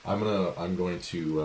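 ordinary delivery

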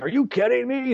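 high, incredulous exclamation; surprised disbelief. Retold or imitated. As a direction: friends can't believe it. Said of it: "And everybody's like".

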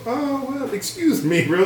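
high mocking "oh well, excuse me, bro"; sarcastic mock-polite brush-off. Mocking an awkward talk with a girl, high and mock polite. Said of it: "How does that conversation go?".

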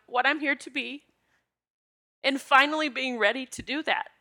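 tearful. Real, not acted. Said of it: "it brings tears to my eyes".

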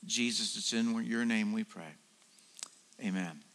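low, soft reverent prayer. Acted. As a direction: pray quietly and low, eyes closed. Opening prayer before talk.

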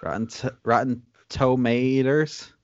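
TOMATOES slowly drawn out as "toe-may-ders"; exaggerated pronunciation. Shown as a demonstration. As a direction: overdo Tomatoes, slow, with an r-coloured ending. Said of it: "That's how you pronounce it".

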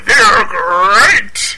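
booming mascot catchphrase; Tony the Tiger voice. Retold or imitated. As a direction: belt it like a cereal mascot.

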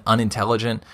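ordinary delivery

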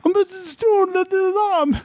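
very high, garbled squeal; mock high-pitched babbling; panicked caller. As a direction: mimic a flustered caller, words smeared together. Imitates garbled panicked caller on phone.

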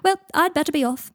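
high, loud, hurried brush-off; breezy. Acted. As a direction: breeze past with a bright excuse. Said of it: "breezing past him".

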